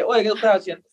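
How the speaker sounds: high, naive voice asking; innocent question. Retold or imitated. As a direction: play the clueless newcomer. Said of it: "Yo le pregunto inocente". (pregunta inocente con voz aguda)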